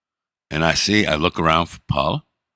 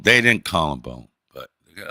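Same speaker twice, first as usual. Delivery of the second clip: normal talking voice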